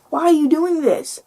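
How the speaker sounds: hint of a complaining child; whiny little kid. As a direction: lightly imitate a pestering kid. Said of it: "when little kids".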